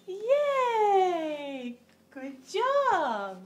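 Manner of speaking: loud, long drawn-out cheer; excited cheering. Real, not acted. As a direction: cheer proudly for a little one. Praising a toddler's success.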